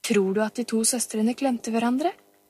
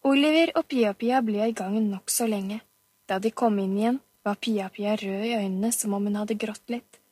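plain reading voice

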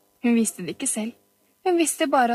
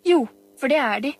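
put-on higher, louder childish contradiction; insistent child; 4 semitones higher, 5 dB louder, faster than usual. A narrator's reading. Pia-Pia contradicts Oliver about the pearls, insistent child. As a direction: insist right back at your brother.

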